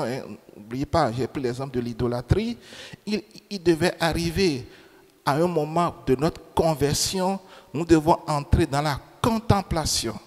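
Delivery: ordinary talk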